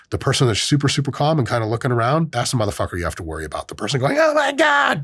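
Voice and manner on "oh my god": much higher mock panicked squeal; high hysterical mock panic; panicked shriek. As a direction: squeal it like a terrified passenger. Panicked plane passenger.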